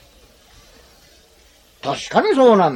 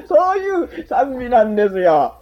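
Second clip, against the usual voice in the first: ordinary talk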